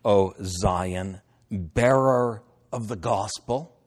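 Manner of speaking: slow, proclaiming Bible reading; stately reading with stretched words; scripture reading. Acted. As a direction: proclaim the verse phrase by phrase. Reads scripture aloud.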